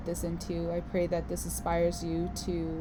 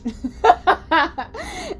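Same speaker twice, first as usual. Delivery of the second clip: loud high burst of laughter; loud high laugh after the punchline; big laugh; 14 semitones higher, 12 dB louder than usual. Real, not acted. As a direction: crack up at your own line. Laughing at her own swearing.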